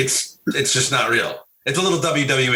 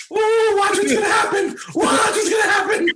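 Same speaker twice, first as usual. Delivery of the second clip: mock hyped warning. Friend at the bar calling out the coming fight, laughing shout. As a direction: egg on the crowd like a ringside hype man. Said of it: "I would sit there and go".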